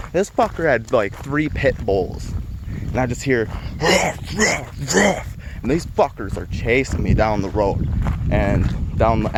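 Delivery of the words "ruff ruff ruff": imitated dog barks. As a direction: bark like a chasing pit bull, rising. Pit bulls chasing him.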